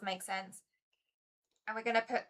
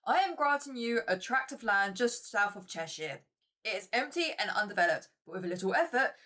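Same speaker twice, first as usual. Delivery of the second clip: attempted posh English accent, a bit louder; 4 dB louder than usual. Reading the father's stern letter aloud in a would-be English accent. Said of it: "read this in my most English voice".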